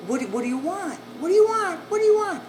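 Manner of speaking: high, loud woman's voice calling through the door; woman behind the door. Retold or imitated. Voice behind door shouting questions.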